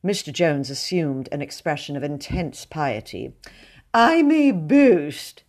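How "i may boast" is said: slow, high, pious boast; sanctimonious. A narrator's reading. Mr Jones boasts to the drawing-room, slow, high and sanctimonious. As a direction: savour every word of the boast. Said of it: "he said sanctimoniously".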